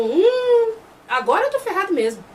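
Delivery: long rising hum, then resigned realization; dawning dismay. Retold or imitated. The chief realizing he is really in trouble, mock dismay.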